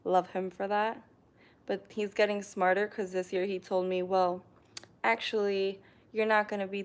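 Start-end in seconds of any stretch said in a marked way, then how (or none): none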